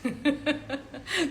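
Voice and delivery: short spontaneous chuckle; laugh. Real, not acted. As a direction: laugh at your own remark. Ri da própria observação. (risada curta e espontânea)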